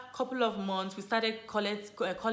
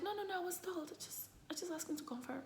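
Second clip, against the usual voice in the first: embarrassed whisper; 9 semitones higher, 13 dB quieter, faster than usual. Retold or imitated. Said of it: "I'd be like".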